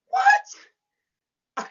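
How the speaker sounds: high, incredulous yelp; shocked "what?". Retold or imitated. Friend reacting to being called just a teacher, shocked. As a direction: blurt it out high in disbelief.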